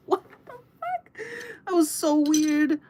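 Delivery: very high, slow, squeaky disbelief; high stretched-out squeak of shock; shocked squeal. Real, not acted. Squealing reaction after the dream.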